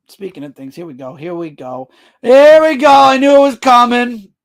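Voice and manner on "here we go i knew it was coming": loud, higher outburst; exclaiming. Real, not acted. Streamer reacting to a comment he knew was coming, loud exclamation. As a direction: call it out loudly, much higher.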